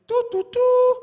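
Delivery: high, loud sung chime; ding the intercom tone; imitated cabin chime. Plane intercom before announcement, high sung chime.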